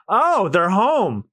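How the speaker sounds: usual speaking voice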